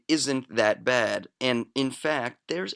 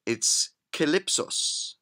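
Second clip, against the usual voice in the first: slow, hammed-up Greek pronunciation of calypsos; a jokey stab at a Greek accent; mock Greek accent; 3 semitones higher, slower than usual. Host showing off his flawless Greek accent, mock Greek. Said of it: "in my flawless Greek accent".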